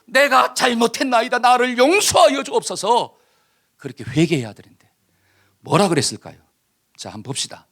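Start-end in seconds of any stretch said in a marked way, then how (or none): 0.0-1.4 내가 잘못했나이다 cried high, loud and slow, begging; pleading confession. Acted. Saul confessing to Samuel, high loud slow pleading of 내가 잘못했나이다. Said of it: "싹싹 빌어야 될 거 아니에요". 1.4-3.2 high, louder, pleading repentance; begging forgiveness. Acted. Saul begging Samuel's pardon for his sin, high pleading cry. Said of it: "싹싹 빌어야 될 거 아니에요".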